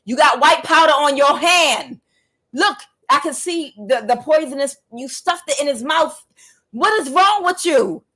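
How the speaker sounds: high-pitched, rising exasperation; outraged accusation. Retold or imitated. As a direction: re-enact confronting dad with the evidence. Retold argument with father.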